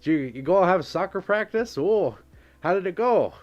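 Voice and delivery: suburban dad voice. Pretend. Streamer jokingly playing dad to a kid after school, hammed-up dad voice. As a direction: play a hearty dad checking in on his kid. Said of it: "That's my dad voice".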